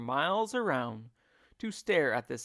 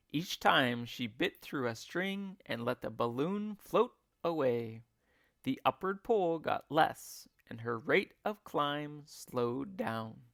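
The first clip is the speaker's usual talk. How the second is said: ordinary delivery, nothing marked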